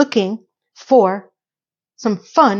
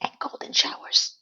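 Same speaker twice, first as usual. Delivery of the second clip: scandalised whisper; shocked whispered punchline; 10 dB quieter than usual. Real, not acted. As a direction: breathe it out like a secret. Shocked listing of ad details.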